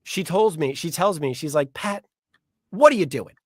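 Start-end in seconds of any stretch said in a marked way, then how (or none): 1.7-3.4 higher, louder scolding wife; lightly voiced scolding wife; berating wife. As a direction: scold him like an exasperated wife. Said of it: "she berates me".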